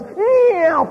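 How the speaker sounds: high-pitched whoosh upward; imitated launch noise. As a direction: voice the actor shooting up into the rafters. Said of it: "when the guy flipped the switch, he goes".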